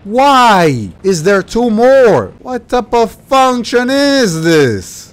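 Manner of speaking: annoyed gamer whine; exasperated whine. Real, not acted. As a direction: drag it out in exasperation. Exasperated at bosses.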